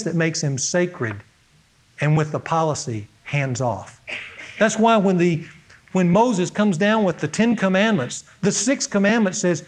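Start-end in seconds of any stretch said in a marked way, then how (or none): none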